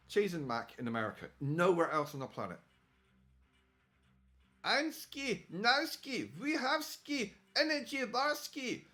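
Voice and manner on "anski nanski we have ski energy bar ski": high mock fake Russian accent. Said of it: "fake Russian".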